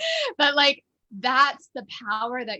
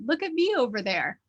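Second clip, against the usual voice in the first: higher, lifting in playful wonder; delighted discovery, pitched up high; playful self-recognition; 7 semitones higher, 5 dB louder than usual. Acted. Teacher acting out her trick for students, playful high-pitched wonder.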